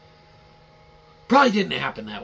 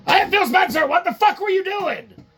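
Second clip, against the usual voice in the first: high, much louder furious boss; angry Phil Spencer; 7 dB louder than usual. Retold or imitated. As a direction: scream down the phone at staff. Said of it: "Game Pass department".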